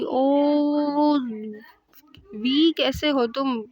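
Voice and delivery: high, drawn-out happy cry; delighted greeting. Retold or imitated. Sister hugging her brother at the hospital, delighted. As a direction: greet him as if overjoyed.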